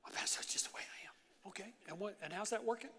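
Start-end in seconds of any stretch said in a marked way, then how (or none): 0.0-1.5 whispered mock excuse of a churchgoer; a whispered, put-on churchgoer excuse; mock whiny excuse. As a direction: breathe it like a lame excuse. Congregant excuse voiced by pastor.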